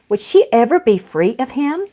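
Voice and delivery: ordinary delivery, nothing marked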